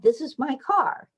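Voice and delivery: put-on high little-girl claim, rising at the end; child's voice. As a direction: say it like a proud preschooler. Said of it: "she said".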